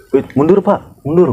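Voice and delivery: high, firm guard telling him back; officious guard calling back; ordering back. Retold or imitated. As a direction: wave the man back with a whistle. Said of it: "gua bilang gitu kan".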